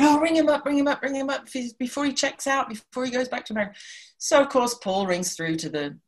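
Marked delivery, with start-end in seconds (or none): 0.0-3.7 high, urgent begging; pleading. Retold or imitated. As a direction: nag him in a high excited rush. Begging Paul to call David.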